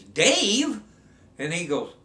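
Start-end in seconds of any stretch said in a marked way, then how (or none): 0.0-0.8 shocked nun. Retold or imitated.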